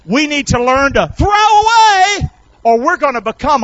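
high, loud exhortation; preacher's shout. Real, not acted. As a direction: shout it to the congregation, holding "throw away" long and high. Preacher drives home the title.

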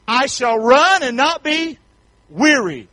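slow, loud scripture line; slow loud preacher scripture; slow emphatic scripture. Real, not acted. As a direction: lean hard into each word. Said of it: "scripture read emphatically".

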